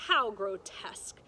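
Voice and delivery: ordinary delivery, nothing marked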